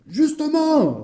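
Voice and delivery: high-pitched angry cry; furious retort. Acted. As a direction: fire back at a high pitch. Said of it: "parut frapper de folie furieuse".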